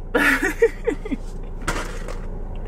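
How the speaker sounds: quiet breathy chuckle; gentle laugh before talking; soft laugh. Real, not acted. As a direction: let a soft airy laugh out. Breathy laugh opening video.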